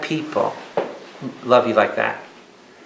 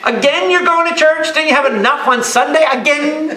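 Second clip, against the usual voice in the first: higher, nagging mock family voice; mocking relative; 9 semitones higher than usual. A relative nagging the churchgoer at home, higher mock voice. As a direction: needle them about going to church again.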